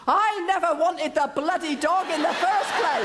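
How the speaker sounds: a touch of grumpy indignation; husband's outburst. Retold or imitated. Angry husband outburst retold.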